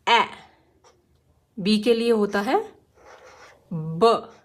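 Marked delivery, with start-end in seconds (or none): 0.1-0.2 ए with the mouth a little open, short; open-mouth a sound. Shown as a demonstration. Teacher demonstrating the phonics sound, ए with mouth opened a bit. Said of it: "थोड़ा सा मुंह खोल के". 3.7-4.3 ब as a clipped lip burst with no vowel after it; short phonics b sound. Shown as a demonstration. Teacher showing kids the pure b sound: ब with no vowel. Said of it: "ब नहीं बोलेंगे".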